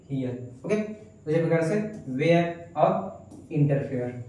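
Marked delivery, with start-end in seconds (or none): 0.1-0.6 हियर said as here, ending like ear, dropping soft; model pronunciation. Shown as a demonstration. Teacher modeling हियर for students, soft model word. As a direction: say हियर softly as the answer. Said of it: "इसको क्या बोलेंगे".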